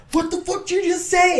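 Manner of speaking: high-pitched incredulous imitation; mock angry outburst. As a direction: imitate an enraged, affronted missionary. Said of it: "the guy was getting kinda mad".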